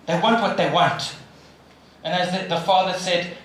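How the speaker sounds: ordinary delivery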